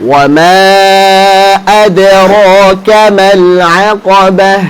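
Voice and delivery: measured recitation with drawn-out vowels; Quran recitation. Shown as a demonstration. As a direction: recite for students to repeat, holding the long vowels. Recites Quran for class to repeat.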